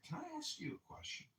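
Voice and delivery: squeaky falsetto pickup line; mock sweet falsetto; high seductive ask. Shown as a demonstration. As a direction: pop the question way up high, soft. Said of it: "Just grab that hand and say".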